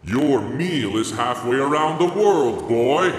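a touch of a roaring north wind; mild roar. Acted. North wind scolding the boy, mildly roaring. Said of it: "the north wind roared".

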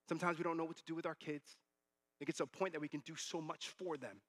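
normal talking voice

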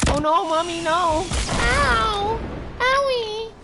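very high, loud mock wailing kid; hammed-up kid crying for mommy; hurt child. Enemy hit by the rocket, very high mock crying child. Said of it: "that rocket came through and said".

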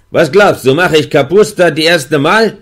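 höher und lauter gerufen; Ruf. A narrator's reading. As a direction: ruf die Frage durch die Tür. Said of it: "Und er hat gerufen". (Wärter ruft zurück, laut)

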